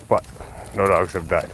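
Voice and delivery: ordinary talk, nothing marked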